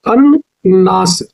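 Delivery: very slow, each syllable of अन्ननास drawn out; slow dictation. Shown as a demonstration. Teacher dictating अन्ननास slowly to students. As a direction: stretch अन्ननास out as an-na-naas for the class. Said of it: "ठीक है, अन्ननास".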